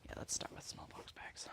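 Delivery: unvoiced whispered decision. Real, not acted. Deciding what to sew first, whispering. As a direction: whisper it to yourself.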